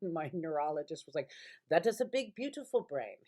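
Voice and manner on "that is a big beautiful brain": higher, louder imitation of an Indian-accented doctor; doctor's reassuring words in an imitated Indian accent. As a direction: quote the neurologist's Indian accent fondly. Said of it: "He's Indian and beautiful accent".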